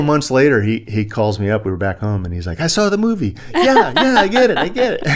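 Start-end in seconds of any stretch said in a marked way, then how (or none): 2.6-5.1 high, loud mimicry of an excited buddy; excitable high-pitched realization, laughing through it; animated wingman. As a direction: imitate him breathlessly won over. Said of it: "he's very animated".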